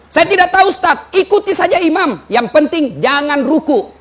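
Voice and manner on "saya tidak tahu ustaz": mock congregant. Congregant confessing ignorance.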